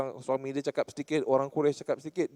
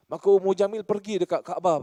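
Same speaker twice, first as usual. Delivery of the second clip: ordinary delivery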